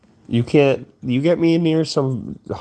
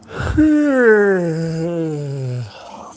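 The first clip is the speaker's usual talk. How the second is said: long sigh; 6 semitones higher than usual. Real, not acted. Weary about sleep schedule.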